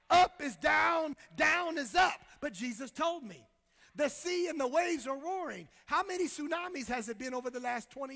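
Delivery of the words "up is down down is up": loud preaching shout; fiery pulpit shout. Real, not acted. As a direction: belt out the upside-down world.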